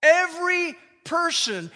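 "Every person" slow, high and loud with stress on "Every"; emphatic stress. Shown as a demonstration. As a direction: hit "Every" high and hard as if triple underlined. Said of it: "It's like it was triple underlined by Paul".

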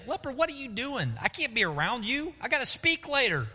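mock a Jesus who pushes the leper away; hammed-up annoyed rejection; dismissive put-off.

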